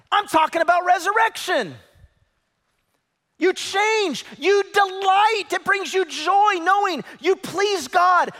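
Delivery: high, loud shouted sermon; shouting preacher. Acted. Pastor shouting about resurrection joy, shouting preacher.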